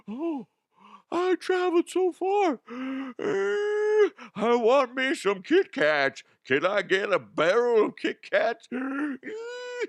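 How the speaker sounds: very high, slow whiny voice, out of breath; squeaky Kit Kat buyer. Pretend. A pretend traveller begging for a barrel of Kit Kats, squeaky and whiny. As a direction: play a tiny squeaky fool begging for candy.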